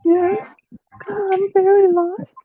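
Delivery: put-on high, breathy new-girl act; girly voice. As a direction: play a shy lost girl. Male friend playing new girl.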